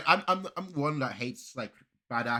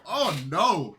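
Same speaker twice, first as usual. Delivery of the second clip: real dismay; 6 semitones higher, 12 dB louder than usual. Real, not acted. Reacting to rap battle.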